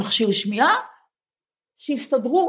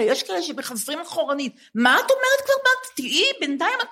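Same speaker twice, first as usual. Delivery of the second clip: mock disbelief; 7 semitones higher than usual. Teasing her friend about stating her age, high mock disbelief. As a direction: pitch leaps much higher in teasing disbelief.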